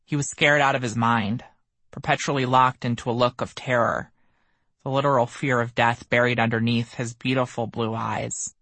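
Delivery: ordinary delivery, nothing marked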